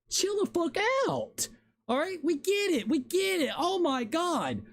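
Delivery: fed-up gamer losing patience; building real exasperation. Real, not acted. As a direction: frustration boiling over, pitch climbing to the top. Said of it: "Yeah, I'm getting annoyed".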